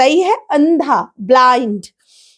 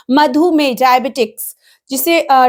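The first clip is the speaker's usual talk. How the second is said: ordinary talk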